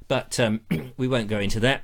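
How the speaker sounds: ordinary talk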